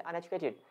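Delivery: soft take, अन over a faded educated; stress on the prefix. Shown as a demonstration. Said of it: "अन ज्यादा सुनाई देगा".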